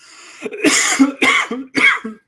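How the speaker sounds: loud hacking cough; harsh cough. Real, not acted. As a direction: cough hard into the mic. Coughing fit on mic.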